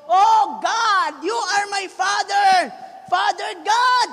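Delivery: imitating a loud desperate prayer; mock prayer shout. Pastor voicing people calling on God.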